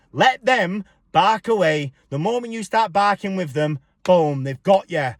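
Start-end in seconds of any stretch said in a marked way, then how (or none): none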